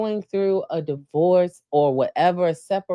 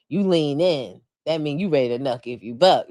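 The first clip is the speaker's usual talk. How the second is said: normal talking voice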